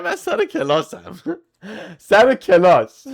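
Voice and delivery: high-pitched, laughing through the words; real laughing incredulity; amused disbelief. Real, not acted. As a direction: crack up while repeating your own excuse. Laughing at own "I'm in class.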